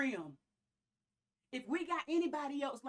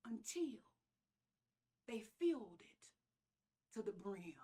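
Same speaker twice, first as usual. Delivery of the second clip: whispered; 5 semitones lower, 7 dB quieter than usual. Real, not acted. Climactic phrase, dropped low.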